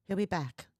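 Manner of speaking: ordinary delivery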